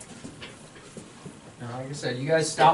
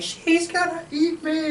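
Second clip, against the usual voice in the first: much higher, slow, panicked squeal; panicked Timon; 12 semitones higher, slower than usual. Retold or imitated. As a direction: squeal it out like a cartoon meerkat being chased. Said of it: "He's like".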